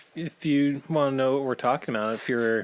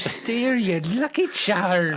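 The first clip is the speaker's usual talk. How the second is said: hammed-up Irish leprechaun, much higher and louder; terrible Irish accent; 12 semitones higher, 5 dB louder than usual. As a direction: play a cartoon leprechaun in a bad Irish accent.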